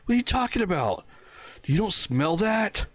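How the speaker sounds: high, disgusted mock coworker; imitating an appalled colleague; grossed-out coworker. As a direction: recoil from the smell and demand answers.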